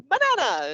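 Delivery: "banana" begun with m instead of b; M-sound banana. Shown as a demonstration. Teacher making a silly snack word for kids, m at the start of banana. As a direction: swap the first sound of "banana" to m. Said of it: "banana with an M sound".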